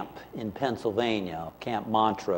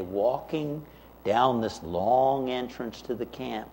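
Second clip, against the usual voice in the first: ordinary talk, nothing marked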